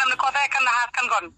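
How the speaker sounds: ordinary talk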